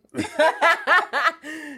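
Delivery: high, loud laugh. Real, not acted. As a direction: burst out laughing. Laughing at retold grandma saying.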